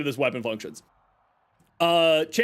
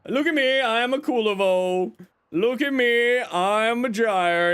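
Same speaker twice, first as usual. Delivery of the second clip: higher mock show-off voice; 6 semitones higher than usual. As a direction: parody a bragging player. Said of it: "Everyone's gonna be like".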